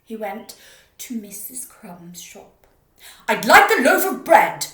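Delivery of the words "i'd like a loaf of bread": huge boom, far louder. Acted. Mister Noisy booming for bread. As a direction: boom the order at the baker. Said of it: "he boomed".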